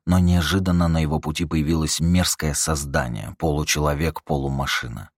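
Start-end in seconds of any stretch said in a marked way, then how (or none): none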